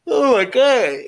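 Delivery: oh my God stretched high through a yawn. Real, not acted. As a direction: let a big yawn pull the words up. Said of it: "I'm yawning".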